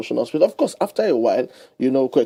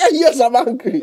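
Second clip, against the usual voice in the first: laughing, higher, playing the angry one; laughing mock retort; 9 semitones higher, 4 dB louder, slower than usual. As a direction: giggle through the angry reply. Voicing the still-angry person.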